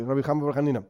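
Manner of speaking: normal talking voice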